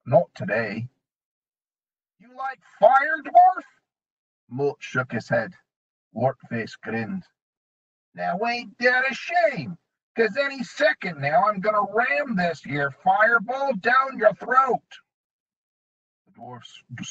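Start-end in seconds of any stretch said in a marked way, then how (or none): 2.2-3.6 goblin threat. A narrator's reading. 8.1-15.0 goblin threat. A narrator's reading.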